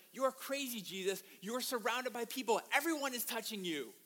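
acted-out incredulous protest; incredulous disciples. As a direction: protest as if it's obviously absurd. Disciples react in disbelief to Jesus.

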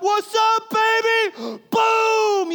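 high, loud mock victory yell at his wife; playful trash-talk shout with a gasp and boom; gloating shout. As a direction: rub the win in her face, hammed up. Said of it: "and I'm like".

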